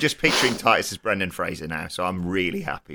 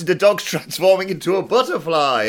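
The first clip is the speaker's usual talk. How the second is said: excited; 8 semitones higher, 6 dB louder than usual. Real, not acted. Excited about puppet transforming.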